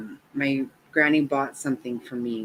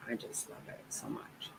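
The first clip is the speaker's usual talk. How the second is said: whispered, almost no voice; breathless whisper; 20 dB quieter than usual. Real, not acted. Grandma whispering her love for her grandson, hushed.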